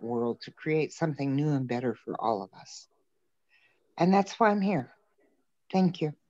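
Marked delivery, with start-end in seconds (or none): none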